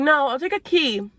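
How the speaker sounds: tearful pleading. Retold or imitated. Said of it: "So I literally start crying".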